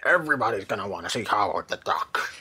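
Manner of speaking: a hint of a brash movie producer; studio executive voice. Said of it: "That's my studio executive voice".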